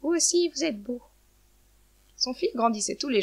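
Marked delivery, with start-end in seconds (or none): 0.0-1.0 high-pitched hen voice; lightly acted hen, pitched up; complimenting hen. A narrator's reading. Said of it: "dit aux pigeons".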